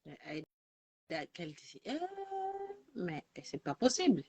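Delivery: distant, far from the mic; talking away from the phone; phone held away. Real, not acted. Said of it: "trop en colère".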